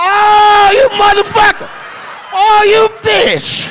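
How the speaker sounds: high, drawn-out indignant shout; comic shriek of disbelief; mock outrage. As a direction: yell it in hammed-up offence. Acted outrage at the impression.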